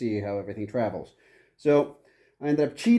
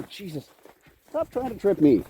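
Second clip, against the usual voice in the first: ordinary talk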